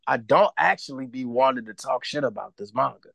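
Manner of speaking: usual speaking voice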